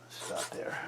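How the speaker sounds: breathy whisper, no voice. Shown as a demonstration. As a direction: whisper it right after the spoken take. Repeats line, then whispers it.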